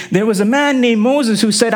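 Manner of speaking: usual speaking voice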